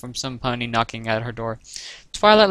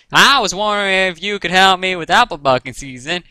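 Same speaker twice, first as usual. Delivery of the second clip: put-on higher, louder country-accent voice; Applejack's country accent; 6 semitones higher, 5 dB louder than usual. A narrator's reading. Applejack asks Twilight for help, country accent. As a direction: ask a favour in a country twang. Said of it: "in her unmistakably country accent".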